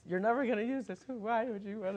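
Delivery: high, giggling through the words; crying with laughter while quoting; laughing. Real, not acted. Actor retelling giggle fit to interviewer, high laughing voice. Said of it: "and I'm crying".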